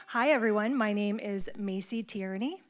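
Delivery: usual speaking voice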